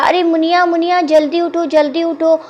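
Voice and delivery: put-on a small boy urgently waking her; urgent child call. A narrator's reading. Child wakes sleeping Muniya at night, in a high urgent call. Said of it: "फिर उसने बोला".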